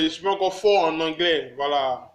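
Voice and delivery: ordinary talk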